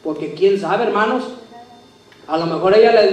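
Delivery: normal talking voice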